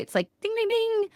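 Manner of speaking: sung-out ding ding like a bell; bell ding imitation. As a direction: ring it like a little bell.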